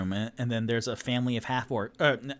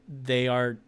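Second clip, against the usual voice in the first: normal talking voice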